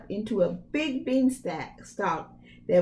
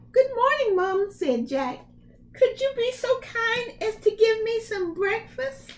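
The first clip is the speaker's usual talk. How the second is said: put-on higher, sweet boyish request; high polite Jack; 13 semitones higher than usual. Jack asking the ogre's wife for breakfast, high boy voice.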